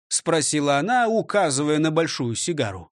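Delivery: usual speaking voice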